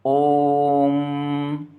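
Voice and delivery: "ओम" slowly, the "ओ" held extra long; chant-like stretched "ओम"; prolonged vowel demo. Shown as a demonstration. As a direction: draw out "ओम" to show an extended vowel. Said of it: "ज़्यादा समय लगता है ना".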